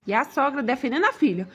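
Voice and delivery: normal talking voice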